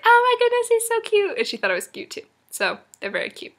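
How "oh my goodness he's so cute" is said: very high, loud imitated squeal; mock fangirl squeal, way up high; gushing squeal. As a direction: gush like a friend melting over someone cute. Imitating friend gushing over cute child.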